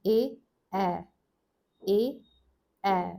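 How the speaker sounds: long clear vowel sound A; vowel demo. Shown as a demonstration. Teacher modelling the vowel sound for students. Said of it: "is the addition".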